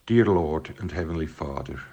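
ordinary talk

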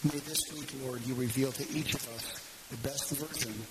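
unhurried prayer with long pauses. Real, not acted. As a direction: address God earnestly, pausing between phrases. Closes the sermon in prayer.

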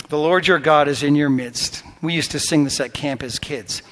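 ordinary talk

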